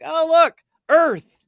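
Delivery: hammed-up cackling alien fan; delighted alien viewer. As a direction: point and laugh at Earth like a TV show. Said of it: "They're like".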